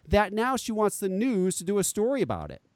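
usual speaking voice

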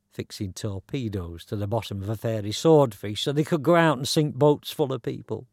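ordinary delivery, nothing marked

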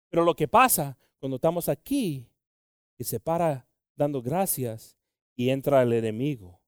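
usual speaking voice

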